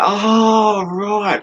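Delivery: high, mock-dawning realisation; sarcastic oh right. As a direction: pretend the penny just dropped. Sarcastic reply to insult.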